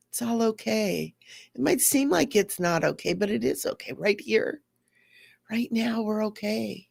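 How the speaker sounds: put-on soft, tender reassurance to a child; soothing. Comforting her hurt feelings like a child, softly and tenderly. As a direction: rock and soothe it gently.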